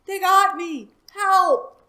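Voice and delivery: high, loud desperate cry. Acted. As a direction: shout as if caught. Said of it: "and cried".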